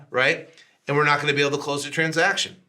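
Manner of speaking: usual speaking voice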